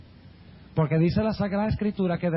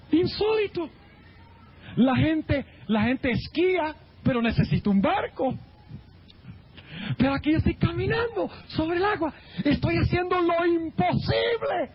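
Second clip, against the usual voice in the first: amazed boast; 9 semitones higher than usual. Retold or imitated. Peter walking on water boasts in higher, amazed delight. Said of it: "Y dice".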